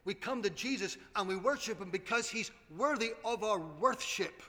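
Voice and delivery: ordinary delivery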